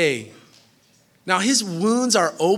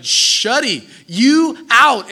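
mock rebuke; 4 semitones higher, 7 dB louder, much slower than usual. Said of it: "he's like".

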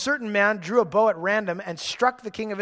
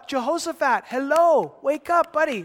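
exasperated wake-up call; 8 semitones higher, 6 dB louder than usual. Acted. Mocking a foolish king.

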